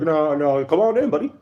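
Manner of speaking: sarcastic open-arms welcome; mock buddy voice shooting way up; mock welcoming host. Mocking Israel welcoming a fugitive.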